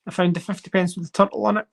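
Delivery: usual speaking voice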